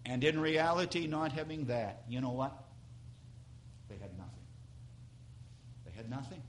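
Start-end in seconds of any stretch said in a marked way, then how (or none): 3.9-4.5 nothing said almost silently, much softer and lower; near whisper. Real, not acted. Repeated line, dropped to near whisper. 5.8-6.4 soft, lower, nothing slightly rising; hushed repeat. Real, not acted. Repeated line, still hushed.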